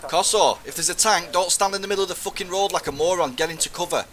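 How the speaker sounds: loud, fast, high furious rebuke; angry yelling. Real, not acted. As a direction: bark at him to take cover. Leader yelling at exposed soldier.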